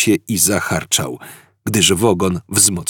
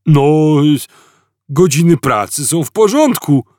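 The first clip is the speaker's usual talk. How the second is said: puzzled guard; 8 semitones higher, 6 dB louder than usual. A narrator's reading. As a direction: sound dim and baffled. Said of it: "powiedział w końcu".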